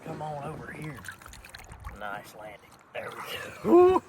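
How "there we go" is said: excited. Real, not acted. Landing the fish, elated.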